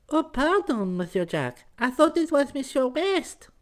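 very high feminine falsetto; flustered young woman. A narrator's reading. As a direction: apologise for mistaking the caller, in falsetto. Girl finds she mistook her caller.

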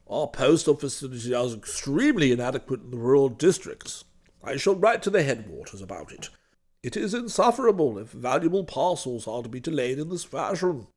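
low, pompous grumbling uncle; indignant old uncle. Acted. Uncle Willoughby complains about the post.

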